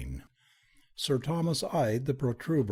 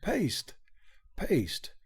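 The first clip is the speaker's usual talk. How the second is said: speaking with difficulty; 7 semitones higher than usual. A narrator's reading. Baronet reeling at the news of paste, speaking with difficulty. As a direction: repeat it in struggling disbelief. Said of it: "speaking with difficulty".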